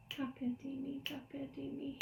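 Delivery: soft, even rhythm syllables; hushed steady count; quiet count-in. Shown as a demonstration. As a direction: set the subdivision under your breath. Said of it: "A little faster".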